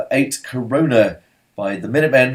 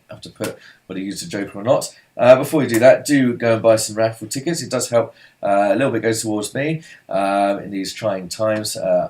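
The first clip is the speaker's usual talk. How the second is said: normal talking voice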